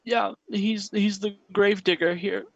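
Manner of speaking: put-on high, broken sobbing child voice; a frightened boy blurting a confession through tears; boy confessing to the priest, high and tearful; crying boy. Boy keeps talking.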